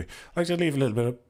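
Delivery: croaky voice cutting out; throat catching, voice breaking off; voice failing. Real, not acted. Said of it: "My voice is failing".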